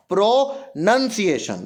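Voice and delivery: प्रोनंसिएशन slowly in pieces, "pro - nun - ciation"; broken-up प्रोनंसिएशन, no "ow" sound; correct pronunciation. Shown as a demonstration. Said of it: "उसकी जगह एन यू एन".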